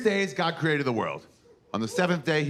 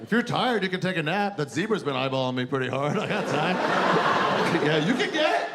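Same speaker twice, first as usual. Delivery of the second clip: Adam taunting; 7 semitones higher than usual. Acted. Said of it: "Adam taunting, zebra flirt".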